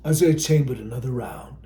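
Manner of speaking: usual speaking voice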